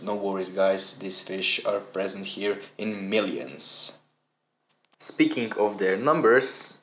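usual speaking voice

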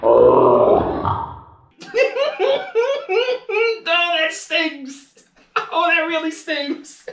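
high, slow pained moan through a laugh; hissing in pain. Real, not acted. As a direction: wince out the words, half laughing. Stung by the shot.

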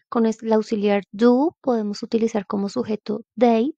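ordinary delivery

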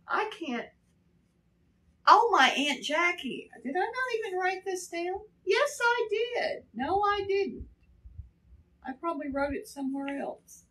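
a flustered scramble for a lost note; flustered self-talk. Real, not acted. Flustered search for missing note.

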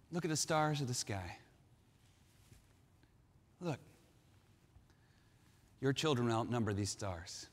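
soft, low voice of God; quiet, awed revelation under the night sky; hushed promise. Acted. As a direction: speak low and softly, with long pauses. God quietly shows Abram the stars.

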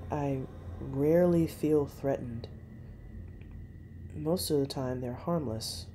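ordinary delivery, nothing marked

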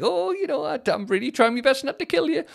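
fake polite. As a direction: smile hard while holding back rage. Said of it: "trying to be all like".

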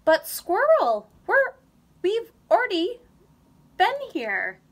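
high, loud exasperated groan. A narrator's reading. Mole groaning to Squirrel about going in circles, exasperated. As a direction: groan it out, fed up. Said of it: "groaned Mole".

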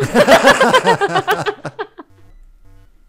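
high, loud burst of laughter; loud laughing fit. Real, not acted. Player cracks up at her botched Pokémon name, laughing loudly. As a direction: explode laughing at your own slip.

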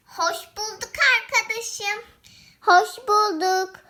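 ordinary delivery

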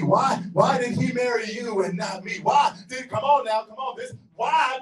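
ordinary talk, nothing marked

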